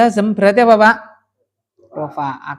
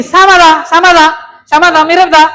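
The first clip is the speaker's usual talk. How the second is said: emphatic repeated question; 7 semitones higher, 7 dB louder than usual. Real, not acted. Teacher stressing the similarity.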